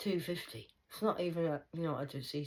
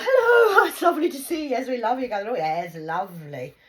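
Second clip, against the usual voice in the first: much higher, much louder sugary fake welcome; mock sweet greeting; 6 semitones higher, 13 dB louder than usual. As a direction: lay on the false friendliness. Mocking fake-friendly greetings.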